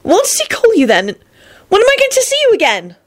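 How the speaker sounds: high voice climbing toward a wail; anxious questioning. Acted. Elizabeth asking Lupin when they'll meet, anxious. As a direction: plead for answers, pitch rising.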